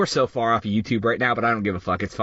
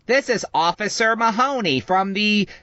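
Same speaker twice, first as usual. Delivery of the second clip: higher, slow robocall voice; automated voice; 7 semitones higher, slower than usual. Retold or imitated. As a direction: read it like a recorded message. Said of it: "it's an automated voice recording".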